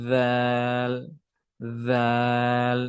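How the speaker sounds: "রাল" as long "dhaal", tongue between teeth; even monotone recited "রাল", said dhaal; soft Arabic dhal. Shown as a demonstration. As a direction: soften the first sound of "রাল" to voiced th, hold aa. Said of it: "যেমন নরম করে".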